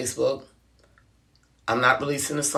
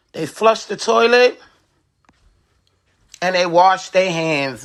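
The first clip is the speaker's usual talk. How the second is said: louder, higher lecturing rant; scolding; 5 semitones higher, 5 dB louder than usual. Real, not acted. Fed-up vlogger scolding viewers about public bathrooms, loud and preachy. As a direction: scold the whole room about hand washing.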